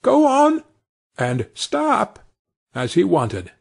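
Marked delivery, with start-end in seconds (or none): 0.0-0.6 high, loud call to the horse; crying out. A narrator's reading. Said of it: "crying out". 1.5-2.2 high sharp call to the horse; crying out. A narrator's reading. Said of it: "crying out".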